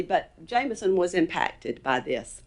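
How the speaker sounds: ordinary talk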